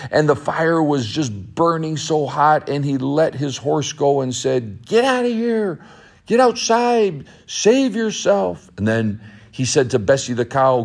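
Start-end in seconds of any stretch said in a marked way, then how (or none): 4.9-8.7 urgent shout. Acted. Urging horse out of burning barn.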